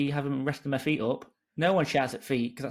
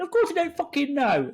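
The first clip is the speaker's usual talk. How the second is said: mock exasperation; 13 semitones higher, 4 dB louder than usual. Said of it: "and you went".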